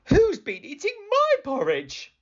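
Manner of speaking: high-pitched indignant mother bear; storybook mama bear, high voice; middle-sized Mother Bear voice. Acted. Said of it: "said Mother Bear in her middle-sized voice".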